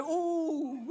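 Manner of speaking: mock ooh. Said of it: "I was like".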